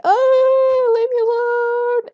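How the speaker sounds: high, loud, hammed-up panic; mock terrified squeal. Gamer imitates the player fleeing night spiders in a mock shriek. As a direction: shriek it like a frightened victim.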